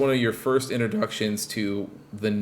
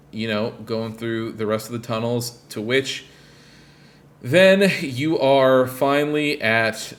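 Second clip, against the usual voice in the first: ordinary delivery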